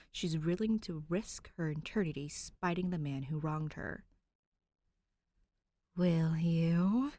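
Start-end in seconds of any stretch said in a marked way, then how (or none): 6.0-7.1 louder insistent ghost voice; ghost repeating. A narrator's reading. Ghost bride pressing Danny for help. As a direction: press her again, spiteful. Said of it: "she repeats".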